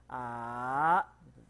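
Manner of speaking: A drawn out slowly, climbing from low; slow rising tone. Shown as a demonstration. Teacher repeats the rising tone for the student. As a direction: stretch A upward slowly. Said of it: "dari rendah naik ya".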